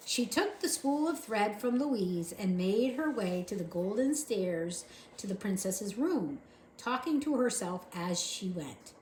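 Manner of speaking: plain reading voice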